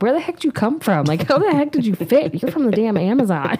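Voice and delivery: high, loud disbelieving rant; mock outrage at her size; exasperated disbelief. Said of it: "I'm like".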